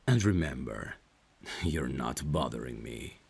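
plain reading voice

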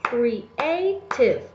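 "Creative" pulled apart into three beats; "Creative" in three deliberate, separated pieces; syllable by syllable. Shown as a demonstration. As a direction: stretch "Creative" out with gaps between syllables. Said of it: "to identify how many syllables".

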